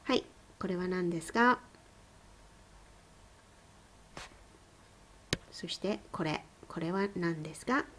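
fast, soft quiz questions in Japanese; quick quiz prompts. Shown as a demonstration. Teacher quizzing class on kana, fast and soft. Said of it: "please take a moment to say".

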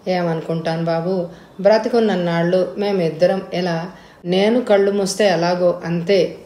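ordinary delivery

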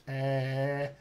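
soft, drawn-out Scottish "uh"; Scottish hesitation. Retold or imitated. Coach imitates his Scottish client, Scottish hesitation. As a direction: hold an Edinburgh Scottish thinking sound. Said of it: "says like that".

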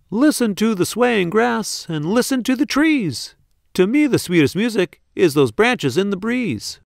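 high, kindly little insect voice; gentle cricket. A narrator's reading. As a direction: coax him like a wise tiny friend. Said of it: "coughed a cricket".

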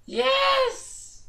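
very high squeal of joy; excited shriek. Real, not acted. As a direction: squeal with delight. Ranks up in game.

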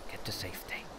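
breathy whispered instruction. Real, not acted. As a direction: whisper it to yourself. Whispered repeat.

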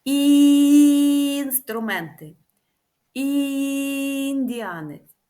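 "Інструменти" with the first І held long; slow, long first I; drawn-out initial I. Shown as a demonstration. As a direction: stretch the І at the start of each word. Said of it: "вимовляючи звук І".

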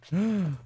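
sharp high gasp of alarm; shocked gasp. Real, not acted. Gamer sees a villager grab his hook, shocked gasp.

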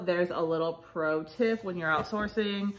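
ordinary talk

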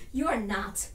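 low, quiet rebuff; hushed, contemptuous put-down; cold denial. Acted. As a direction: drop low and shut them down. Acted quarrel, cold denial.

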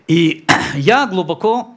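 ordinary delivery

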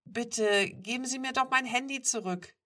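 high, loud, urgent plea; pleading. A narrator's reading. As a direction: beg to get it back. Begs stranger for her phone.

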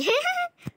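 short high laugh. Real, not acted. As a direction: burst out laughing. Laugh after sarcasm.

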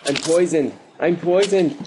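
high, loud alarm; panicked cry. Real, not acted. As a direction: yelp it in panic. Panic while being swarmed.

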